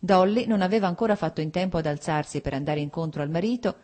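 ordinary delivery, nothing marked

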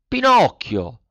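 high, eager puppet. A narrator's reading. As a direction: Pinocchio answers the snail, high and eager. Pinocchio voiced. (burattino acuto)

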